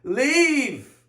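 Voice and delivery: pleading mock scream, stretched at the top of the voice; imitated screaming plea. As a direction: wail it like a panicked ruler begging them gone. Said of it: "And he starts screaming".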